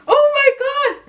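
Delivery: very high, drawn-out squeal; squealing excitement. Retold or imitated. Younger sister seeing the ring, slow high squeal. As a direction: stretch out the delight. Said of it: "And I'm like".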